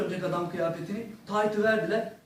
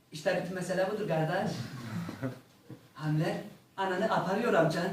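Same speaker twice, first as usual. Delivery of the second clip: put-on Azeri accent; 2 semitones lower, 5 dB quieter than usual. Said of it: "böyle bir şiveli".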